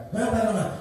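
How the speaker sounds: Jibril asking. Acted. Jibril asks why.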